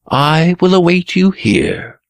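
high, simple reply; Blue Prince replying. Acted. Blue Prince answers a taunt.

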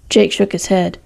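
usual speaking voice

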